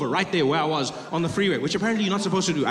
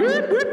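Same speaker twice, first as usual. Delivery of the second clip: high, loud siren whoops; sharp squealed whoops; imitated police siren; 3 dB louder than usual. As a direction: mimic a police siren with your voice. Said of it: "he's like".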